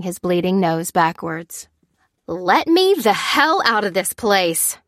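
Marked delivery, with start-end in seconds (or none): 2.3-4.8 drawn-out, heated cry; mildly raised, slow, indignant cry. A narrator's reading. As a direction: cry out to be released, stretching the words. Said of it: "cried the woman in the gown".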